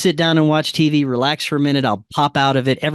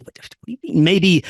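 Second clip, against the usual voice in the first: high, sharp disbelief; pitched up in disbelief, as if chasing after him; incredulous retold question; 7 semitones higher, 6 dB louder than usual. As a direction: demand an answer, thrown and rattled. Confronting the Corey figure, who walks away.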